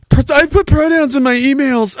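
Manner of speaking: high, mocking whiny voice; mock whining. Veteran mocking today's soldiers, high whine. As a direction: put on a precious complainer's voice.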